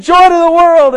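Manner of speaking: high-pitched urgent call; acted shouting. Rider trying every phrase on runaway dogs, high acted shout. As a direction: throw out another command, high and urgent.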